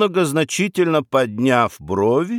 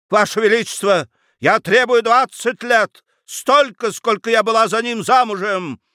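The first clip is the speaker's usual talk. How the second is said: shrieking demand; 9 semitones higher than usual. Acted. Baroness demanding revenge sentence.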